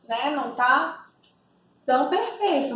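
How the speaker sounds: normal talking voice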